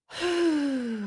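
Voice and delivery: long audible exhale; calming sigh. Shown as a demonstration. Parent calming down before leaving store, demonstrated sigh. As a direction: blow the tension out in one breath.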